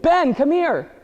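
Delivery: high mock yell across the yard; calling out. Brother screams from the trees.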